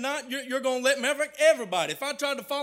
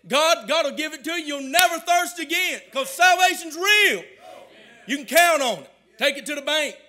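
raised, loud preacher building to a shout; full-force preaching; 4 semitones higher, 5 dB louder than usual. Real, not acted. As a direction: build to a ringing shout for the congregation. Preaching climax to congregation.

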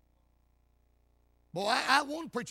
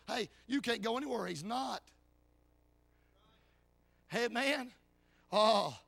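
ordinary delivery

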